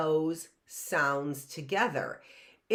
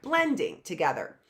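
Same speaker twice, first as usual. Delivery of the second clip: ordinary talk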